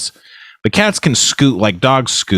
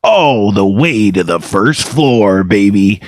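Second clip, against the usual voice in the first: the Spleen. Acted. Morning DJ bantering with co-host, hammy Wolfman Jack-style voice. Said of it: "really good Wolfman Jack impression".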